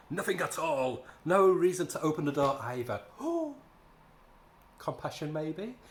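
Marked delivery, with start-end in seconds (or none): 3.0-3.5 mock gasp. Mocking the heroine's reaction.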